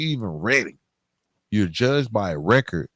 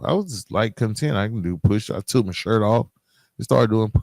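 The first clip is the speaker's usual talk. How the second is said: usual speaking voice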